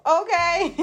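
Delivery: okay melting into a giggle; laughing. Real, not acted. Feeling herself about her hairstyle, laughing. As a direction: let a laugh take over the word. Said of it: "I'm just feeling my damn self".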